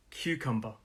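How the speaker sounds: "cucumber" as three neat syllables, softly falling; quiet teacher's demonstration of "cucumber"; three clear syllables. Shown as a demonstration. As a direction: model "cucumber" syllable by syllable, low and soft. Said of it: "Three syllables".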